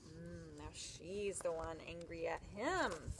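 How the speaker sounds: plain reading voice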